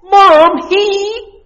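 put-on very high whiny child; whiny tattling child. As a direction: whine like a tattling kid. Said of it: "tattle with a whiny voice".

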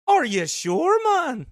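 mock Scottish accent through laughter; imitating a Scottish accent while laughing. Teasing Joe with the espresso man's line, laughing in a mock Scottish accent. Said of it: "Do I have it down pretty good".

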